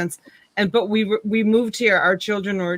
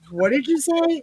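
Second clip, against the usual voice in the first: higher-pitched, incredulous question; retold shock, pitched up; mock disbelief; 7 semitones higher than usual. Mother questioning daughter's new word, mock disbelief.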